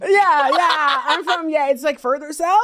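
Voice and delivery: flustered nervous laughter. Retold or imitated. Caught liar answers the Australian guy, flustered laughing. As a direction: stall and laugh nervously through the lie. Flustered lying in fake accent.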